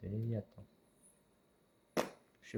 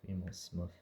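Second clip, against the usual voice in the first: usual speaking voice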